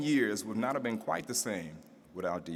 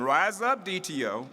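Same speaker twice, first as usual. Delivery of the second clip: rising to a big, louder, higher announcer call; building introduction; 5 semitones higher, 6 dB louder than usual. Acted. Emcee hyping the crowd for the mayor, rising announcer shout.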